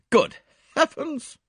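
high, loud, drawn-out exclamation; scandalised high-pitched outburst; shocked cry. A narrator's reading. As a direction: cry out in outrage. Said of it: "cried Miss Howard".

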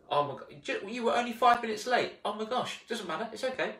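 high, sugary mock sympathy; gushing reassurance. Friend gushing over a late arrival, high and sugary. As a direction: coo it like a doting friend.

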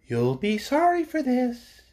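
a tiny bird warning gravely; small sparrow voice. A narrator's reading. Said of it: "exclaimed a small voice".

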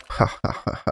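deliberate ha-ha of a victor; mock villain laugh. Alien queen player over a beaten foe, mock evil laugh.

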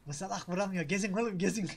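normal talking voice

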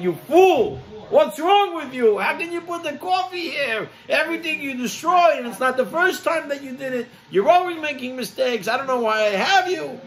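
furious screaming. Retold or imitated. Darshan screaming at the attendant who spilled coffee, furious shout. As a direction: tear into him at full volume. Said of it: "He starts to screaming at him".

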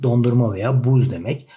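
ordinary delivery

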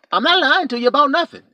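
usual speaking voice

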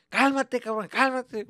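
loud, high scolding in Spanish; irritated dad. Retold or imitated. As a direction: tell the kid off to calm down. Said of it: "And you're dancing".

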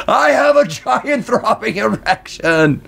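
half-volume retold holler; mock screaming. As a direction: imitate your own scream, slowed down. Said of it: "Just screaming".

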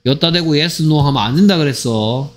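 usual speaking voice

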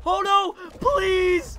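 loud, high plea; desperate shout. Real, not acted. Gamer about to lose the round, desperate shout. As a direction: cry out begging the game for mercy. Pleading as game goes wrong.

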